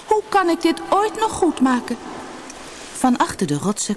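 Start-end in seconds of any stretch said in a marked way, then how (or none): 0.1-2.0 high-pitched, regretful plea; crying out. A narrator's reading. Sina despairs of making amends, crying out high. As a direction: lift the voice in dismay. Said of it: "riep ze toen luid".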